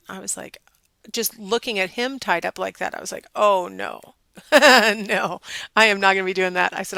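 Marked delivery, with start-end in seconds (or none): 3.3-6.8 louder, laughing through a firm no; incredulous laughing no; laughing refusal. Retold or imitated. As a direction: laugh it off while refusing flatly. Wife refuses tied-up mummy bag.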